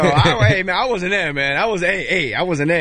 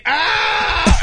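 sudden yelp, much higher; high pained yelp; 3 dB louder than usual. Retold or imitated. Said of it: "brushing your teeth going".

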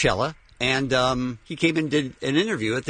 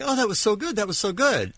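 higher-pitched quoted praise; gushing colleagues; 6 semitones higher than usual. Retold or imitated. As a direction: lightly imitate people gushing afterwards. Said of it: "everybody's like".

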